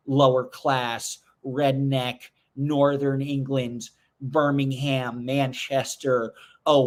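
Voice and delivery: slow, drawn-out mock disdain; deliberately laboured, teasing put-down; mock snobbery. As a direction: stretch each label out like a pretend snob. Mock rant at class, then kidding.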